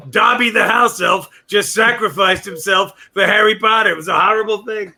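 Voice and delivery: mock-tragic outburst about Dobby; mock grief shout. Mock Trump grieving over book.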